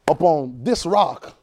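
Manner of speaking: slow, deliberate "this" before "rock"; single out "this" rock, taking your time; measured phrase leaning hard on "this"; stress on "this". Shown as a demonstration. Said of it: "Not that rock".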